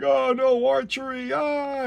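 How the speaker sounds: high, loud mock panic, a falsetto wail; hammed-up dismay. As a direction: squeal it like a cartoon hero in horror. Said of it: "Hercules like".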